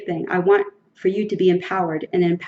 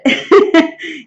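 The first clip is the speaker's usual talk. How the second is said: loud laugh; 9 semitones higher, 9 dB louder than usual. Real, not acted. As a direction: burst of loud high laughter. Laughs after the hell with it.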